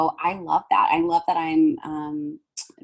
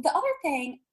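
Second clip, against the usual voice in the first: ordinary delivery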